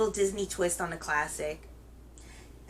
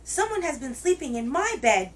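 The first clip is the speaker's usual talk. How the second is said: high, wee little bear voice; Baby Bear; 6 semitones higher, 4 dB louder than usual. A narrator's reading. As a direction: protest as a tiny cub, high-pitched. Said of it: "in his wee little voice".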